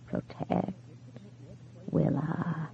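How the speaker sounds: breathy whisper, faint and far away; almost lose the words. A narrator's reading. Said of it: "much softer than before".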